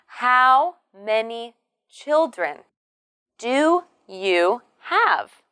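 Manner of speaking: put-on slow, with "How many", "children" and "have" stressed; key words stressed. Shown as a demonstration. Teacher models the question for learners, key words stressed. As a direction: spell out the question word by word, falling on "have". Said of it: "we stress the words how many, children".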